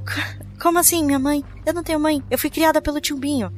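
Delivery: stunned. Acted. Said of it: "Eu tô bem atordoada".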